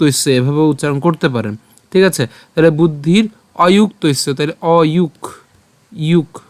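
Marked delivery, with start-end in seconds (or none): 6.1-6.4 যুক ending on a bare ক; stopped final consonant. Shown as a demonstration. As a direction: stop যুক at ক, no vowel after. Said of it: "ক হসন্ত পর্যন্ত উচ্চারণ করলাম". (যুক-এর ক হসন্ত উচ্চারণে)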